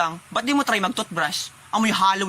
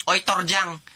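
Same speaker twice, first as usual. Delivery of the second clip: ordinary delivery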